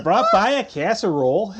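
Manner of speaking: high, loud, laughing Minnesota twang; mock Midwestern accent. Co-host piling on casserole jokes, mock Midwestern accent. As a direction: Minnesota hostess breaking into laughter. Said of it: "It's really Midwestern".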